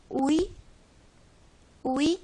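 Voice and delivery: ui, claro y fuerte, cada vocal marcada; diptongo ui. Shown as a demonstration. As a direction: decir ui con énfasis en el diptongo. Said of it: "Esto puede dar lugar a un diptongo". (diptongo ui pronunciado con claridad)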